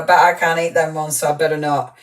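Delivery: ordinary talk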